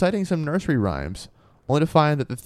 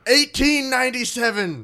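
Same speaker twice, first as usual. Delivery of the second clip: announced year; 11 semitones higher than usual. Acted. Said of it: "I think yelling the year".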